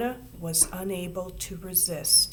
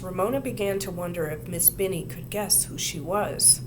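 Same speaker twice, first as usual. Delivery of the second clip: usual speaking voice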